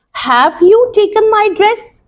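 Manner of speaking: whole question lifted high, rising on "dress?"; rising yes-no question. Shown as a demonstration. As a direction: ask it up high, ending on a rise. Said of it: "Either in a yes or no form".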